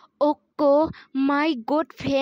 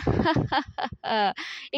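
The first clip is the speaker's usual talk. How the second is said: laugh; 3 dB quieter than usual. Real, not acted. Laughs at English mix-up.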